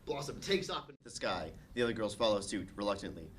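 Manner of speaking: ordinary delivery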